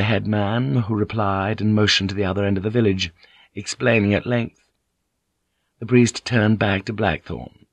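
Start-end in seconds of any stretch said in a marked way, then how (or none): none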